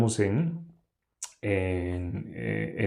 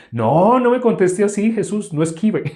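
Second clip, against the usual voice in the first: high, loud mock demand; mock accuser; 10 semitones higher, 7 dB louder than usual. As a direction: play a cornering questioner. Voicing hostile questioners to Jesus. (acusador burlón, agudo y fuerte)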